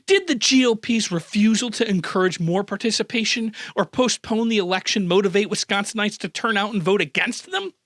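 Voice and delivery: ordinary talk, nothing marked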